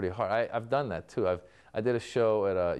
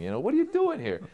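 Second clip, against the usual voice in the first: mock exasperation; 5 dB louder than usual. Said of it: "you're like".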